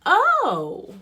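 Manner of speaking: high, loud "oh" of shock; startled gasp. Acted. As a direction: cry out as a monster suddenly appears. Mouse suddenly sees the monster.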